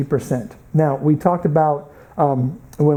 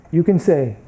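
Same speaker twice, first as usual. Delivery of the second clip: ordinary talk, nothing marked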